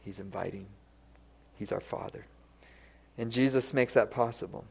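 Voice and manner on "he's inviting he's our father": whispered, reverent; hushed whisper. Real, not acted. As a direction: drop to a whisper for the truth. Sermon climax, intimate.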